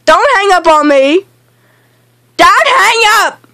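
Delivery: high, urgent holler; yelling. Real, not acted. Gamer orders teammate not to hang up, yelling. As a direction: yell it high, climbing on the repeat. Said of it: "No, stop yelling".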